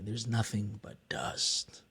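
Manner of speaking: hushed whisper, barely voiced; grieving father's line whispered softly.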